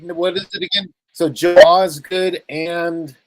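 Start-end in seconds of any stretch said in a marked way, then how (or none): 1.1-3.1 slow careful name. Shown as a demonstration. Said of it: "And, uh, repeat".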